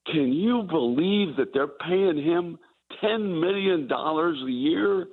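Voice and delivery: high, slow mock disbelief; incredulous writer. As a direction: act out the writer's shock at the salary. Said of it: "one of the writers said".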